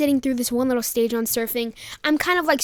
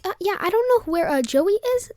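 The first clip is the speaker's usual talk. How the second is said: higher, hesitant mock innocence; halting, raised-pitch excuse; nervous fibbing; 7 semitones higher than usual. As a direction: act out nervously covering for a friend. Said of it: "me being nervous".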